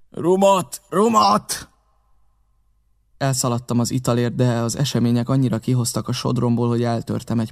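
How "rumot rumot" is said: higher, louder demand for rum; the captain calls out "Rumot!" twice, pressing. A narrator's reading. Staggering captain calls out for rum, higher and louder.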